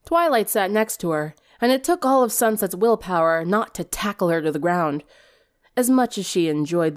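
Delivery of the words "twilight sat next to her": ordinary delivery, nothing marked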